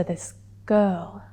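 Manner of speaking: measured dramatic telling with long pauses; confessional stage monologue. Acted. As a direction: let each memory land, pausing between phrases.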